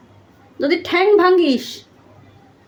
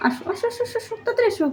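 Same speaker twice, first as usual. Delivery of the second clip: higher, much softer cooing; cooing call; 6 semitones higher, 8 dB quieter, faster than usual. Real, not acted. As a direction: lure the pet down with a sweet, high coo. Owner cooing pet to come.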